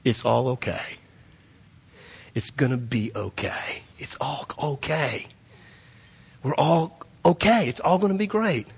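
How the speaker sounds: put-on soft, hushed comfort to a crying child; mother hushing her scared son in the car, softly; tender reassuring mother. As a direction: whisper it gently like a mom soothing her boy. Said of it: "touches me in the back seat and says".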